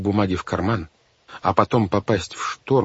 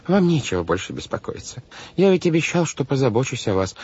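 higher-pitched fussy banker; patronizing guardian; 6 semitones higher than usual. A narrator's reading. Banker tells orphans to stop worrying, patronizing. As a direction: reassure children as a fussy guardian. Voices Mr Poe reassuring the orphans.

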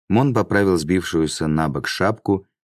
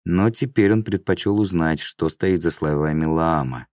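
usual speaking voice

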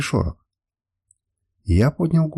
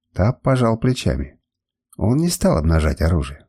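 usual speaking voice

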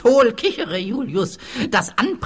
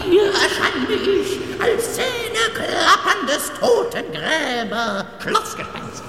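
chattering teeth; 11 semitones higher, slower than usual. Acted. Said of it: "als zähneklappernder Totengräber".

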